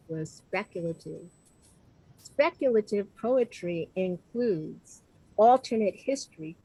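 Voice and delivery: ordinary talk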